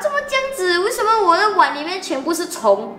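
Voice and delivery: high, startled cry of disgust; shocked girl. Retold or imitated. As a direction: recoil at worms in the bowl. Girl finds worms in bowl. (惊恐的高声)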